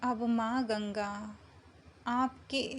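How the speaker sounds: slow meditation voice with long pauses between phrases; unhurried, measured meditation guidance; guided meditation. Acted. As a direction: guide them gently into calm, drawing out the words, phrase by phrase.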